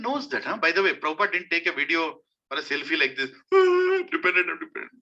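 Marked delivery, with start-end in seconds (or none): 3.5-4.9 high mock wail, then whimpered words; mock crying. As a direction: pretend to sob for the camera. Mimes crying for a selfie.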